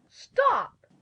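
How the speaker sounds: very high-pitched squawked order; sudden hen cry. A narrator's reading. Hen stopping the Scarecrow reaching for the egg, crying out. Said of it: "the hen suddenly cried".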